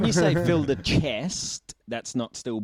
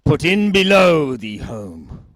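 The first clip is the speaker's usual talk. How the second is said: pirate voice; 2 semitones lower, 8 dB louder than usual. Pretend. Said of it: "have you got a pirate voice".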